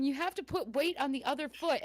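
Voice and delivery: ordinary talk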